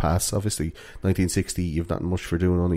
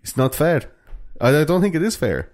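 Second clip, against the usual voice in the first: put-on higher, halting Finnish accent; 4 semitones higher than usual. Host as Finnish teen faulting the schools, comic Finnish accent. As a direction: protest the unfairness in a Finnish accent. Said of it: "That's a pretty good Finnish accent".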